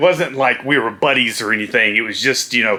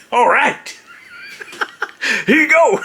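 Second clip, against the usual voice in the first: high, bright-free eager all right; nervous laughing eager reply; 11 semitones higher than usual. Retold or imitated. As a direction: pitch up eager to please.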